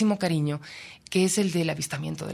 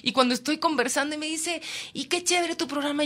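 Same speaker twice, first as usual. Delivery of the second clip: effusive; 11 semitones higher than usual. Retold or imitated. Effusive chat with stranger.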